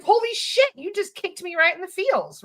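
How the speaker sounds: high-pitched imitation of a stunned bully; mock freak-out. Bully freaks out at unexpected love, mock freak-out. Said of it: "that bully freaks out".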